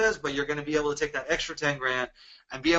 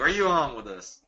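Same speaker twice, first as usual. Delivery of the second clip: ordinary talk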